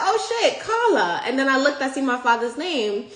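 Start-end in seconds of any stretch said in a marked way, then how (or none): 0.1-1.2 high, laughing shock. Retold or imitated. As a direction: burst out in amazed disbelief. Seeing her name on book.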